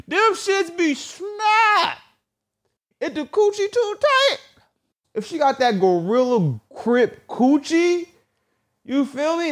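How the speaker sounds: high hammed-up falsetto rant; squeaky mock voice. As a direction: go way up high and comic.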